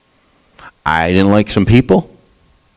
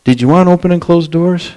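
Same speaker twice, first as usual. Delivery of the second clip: higher, pointed question from above; God's reply; 8 semitones higher than usual. Retold or imitated. God answering the angry preacher, higher pointed question. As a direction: ask it like God gently calling him out. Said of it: "it just hit me over the head and said".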